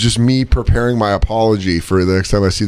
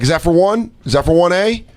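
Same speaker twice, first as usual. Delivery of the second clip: higher-pitched, testy demand; testy questioning; 6 semitones higher than usual. Retold or imitated. Man asking driver about package, testy rising question.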